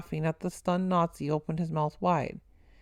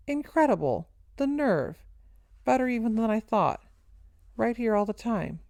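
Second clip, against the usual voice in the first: ordinary delivery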